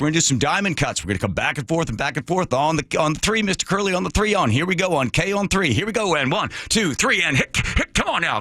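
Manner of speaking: high, urging pilot countdown; hyped pilot coach voice. Retold or imitated. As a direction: push the passenger like a pilot. Pilot coaching during maneuver.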